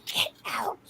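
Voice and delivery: hushed, whispered ghostly growl; growly spirit voice. Retold or imitated. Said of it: "like that, a real growly voice".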